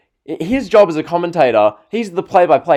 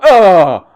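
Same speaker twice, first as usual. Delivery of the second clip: loud, hammed-up "oh" groan; mock commentator groan; 3 semitones higher, 8 dB louder than usual. Vlogger mimicking the commentator at a kick out, mock groan. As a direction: groan like an announcer at a near fall. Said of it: "the groan, like".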